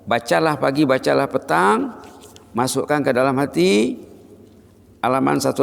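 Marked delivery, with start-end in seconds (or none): none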